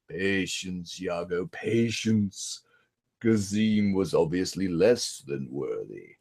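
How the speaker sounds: hushed villain voice, low and slow; low menacing whisper. Retold or imitated. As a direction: whisper it like a scheming sorcerer. Jafar calming parrot.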